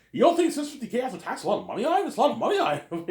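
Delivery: high, rushed disbelief; mock incredulous protest. Arguing with Ivan over the pay, high and incredulous. Acted argument over a paycheck.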